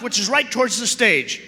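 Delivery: ordinary talk, nothing marked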